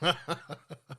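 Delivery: brief quiet chuckle; soft laugh. Real, not acted. Coach amused at fumbling the word, soft laugh. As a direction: chuckle softly at your own slip.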